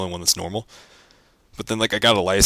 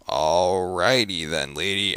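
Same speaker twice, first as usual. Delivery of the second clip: dismissive; much slower than usual. Retold or imitated. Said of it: "I'm like".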